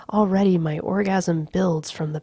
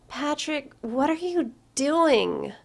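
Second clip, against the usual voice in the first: higher woman's voice calling across rooms; calling from bedroom; 9 semitones higher, slower than usual. A narrator's reading. Courtney calling to Patrick from the bedroom, higher, puzzled. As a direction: call it out from the next room, puzzled. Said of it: "she calls from the bedroom".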